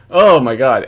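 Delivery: normal talking voice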